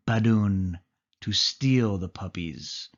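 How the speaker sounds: ordinary delivery, nothing marked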